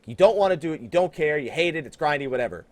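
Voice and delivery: ordinary delivery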